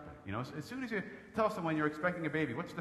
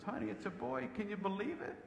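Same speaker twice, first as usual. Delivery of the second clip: higher, softer imitation of an emotional wife; mock tearful wife; 5 semitones higher, 7 dB quieter than usual. Wife on the delivery table to husband, mock tearful. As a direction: gently mimic a moved new mother. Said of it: "she's crying, and she goes".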